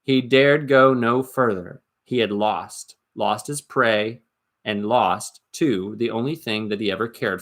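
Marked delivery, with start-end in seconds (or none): none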